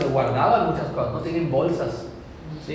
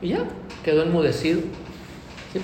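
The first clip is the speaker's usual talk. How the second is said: ordinary delivery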